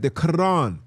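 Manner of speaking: loud, high "Quran", leaning hard on the last syllable; exaggerated pronunciation. Shown as a demonstration. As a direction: hit the end of "Quran" high and loud, then drop. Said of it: "You gotta say it like that".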